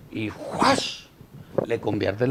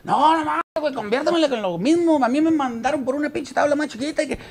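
much higher whiny plea; high pleading voice; 12 semitones higher than usual. Retold or imitated. Surfer begs the magician to shrink the board, squeaky and high.

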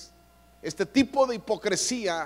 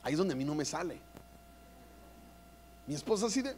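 usual speaking voice

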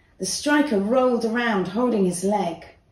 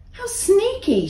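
crossly; 12 semitones higher than usual. A narrator's reading. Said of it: "she thought crossly".

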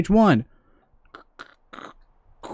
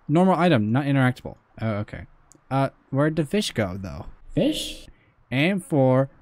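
normal talking voice

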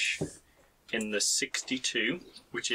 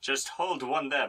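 usual speaking voice

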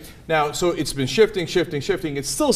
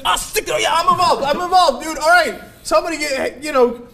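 mock Hannity; 9 semitones higher than usual. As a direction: blurt it like a caught flip-flopper. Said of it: "he's like".

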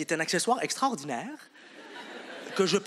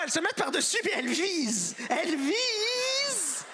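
mocking voice; 10 semitones higher, slower than usual. Mocking girls playing baseball.

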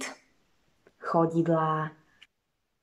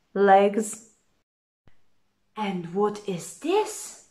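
usual speaking voice

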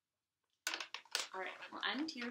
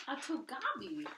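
startled gasp; 5 semitones higher, 4 dB louder than usual. Acted. Fearful cry of goblin.

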